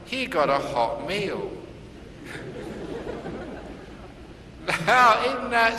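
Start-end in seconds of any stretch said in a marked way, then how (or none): 1.6-4.8 high breathy laughter; real amused laugh; long soft laugh. Real, not acted. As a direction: laugh at your own punchline. Laugh at punchline.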